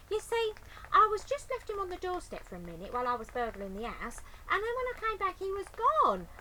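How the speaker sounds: high, loud, fast babble; Betty's explanation. Acted. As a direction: rush through the story.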